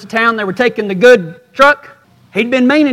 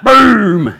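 loud mock gunshot; shotgun boom; 4 dB louder than usual. Imitating the shotgun blast.

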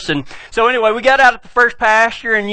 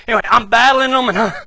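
usual speaking voice

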